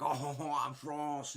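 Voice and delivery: high, loud hammed-up fake French accent; mock the game's cheesy French voice actor. Mocking the pinball game's voice actors, fake French accent. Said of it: "most stereotypical European, like, fake accents".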